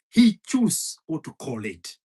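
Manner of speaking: normal talking voice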